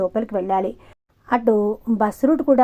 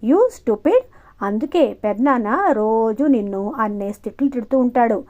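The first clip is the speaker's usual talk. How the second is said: ordinary delivery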